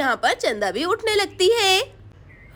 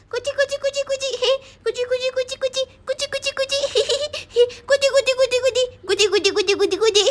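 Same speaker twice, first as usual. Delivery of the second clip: tickling coo; 6 semitones higher, faster than usual. Acted. Boy tickles the sleeping girls, giggling. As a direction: tease them awake with a sing-song tickle.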